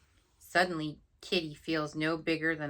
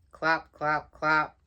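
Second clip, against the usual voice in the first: loud hoofbeats; 8 dB louder, slower than usual. Acted. Hoofbeat sound effect.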